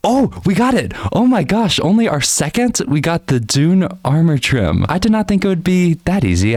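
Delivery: high-pitched excited exclamation; delighted surprise. Real, not acted. Gamer finds the armor trim on second try, excited. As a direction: burst out high, thrilled at a lucky find.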